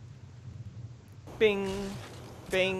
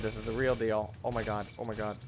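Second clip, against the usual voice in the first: ordinary delivery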